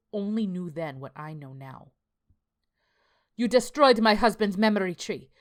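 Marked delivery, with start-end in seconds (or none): 3.4-5.3 loud, high furious yell. Acted. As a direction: scream it at him in fury. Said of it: "she yelled".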